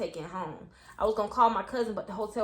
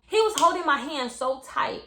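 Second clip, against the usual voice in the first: ordinary talk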